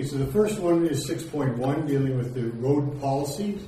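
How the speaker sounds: normal talking voice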